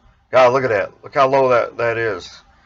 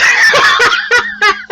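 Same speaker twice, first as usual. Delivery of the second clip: high shrieking laugh; 4 dB louder than usual. Real, not acted. Laughs at own punchline.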